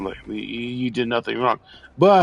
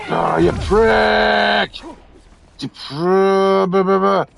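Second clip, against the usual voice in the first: loud, slow, higher drawn-out insult; yelling insult; 6 semitones higher, 4 dB louder, slower than usual. Real, not acted. Player yelling at an enemy who hit him, drawn-out shout. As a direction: stretch the insult out in a yell.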